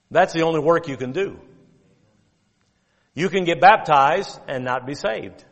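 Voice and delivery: usual speaking voice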